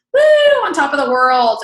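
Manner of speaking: big high-pitched whoop, then loud boast; hammed-up shout of triumph; elated high. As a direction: cheer like you're riding the high. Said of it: "talking about highs and lows".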